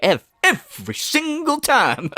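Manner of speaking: ordinary talk, nothing marked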